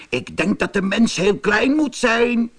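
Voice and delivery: high little mouse voice; squeaking mouse. A narrator's reading. Mouse offers its view to the other animals, squeaking high. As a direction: pipe up high like a small mouse. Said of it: "piepte de muis".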